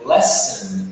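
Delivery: slow "lesson" with a weak "sn" ending; correct English pronunciation. Shown as a demonstration. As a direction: swallow the second vowel of "lesson". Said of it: "A gente fala lesson". ("lesson" com a última vogal reduzida)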